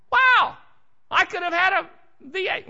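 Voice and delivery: high, loud "wow" like a TV ad; mock ad surprise. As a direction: burst out as if in the old juice commercial. Joking ad quote mid-sermon.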